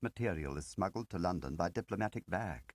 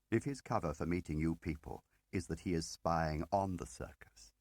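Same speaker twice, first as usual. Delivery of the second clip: usual speaking voice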